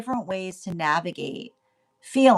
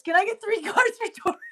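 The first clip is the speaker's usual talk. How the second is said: laughing mid-question, much higher; 12 semitones higher than usual. Real, not acted. Reader asking for Taurus cards, laughing. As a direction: giggle while asking.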